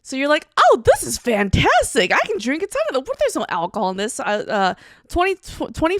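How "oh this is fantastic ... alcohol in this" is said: naive delight. Retold or imitated. Imitates naive soju drinker.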